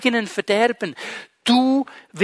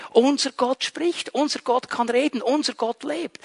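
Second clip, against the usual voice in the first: sincere; 3 semitones higher, 6 dB quieter than usual. Real, not acted. Contrasting living God with idols.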